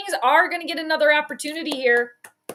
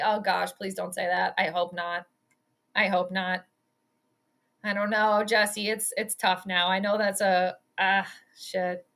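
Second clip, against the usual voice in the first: dejected; 6 semitones lower, 5 dB quieter than usual. Real, not acted. Dreading loss, a bad call.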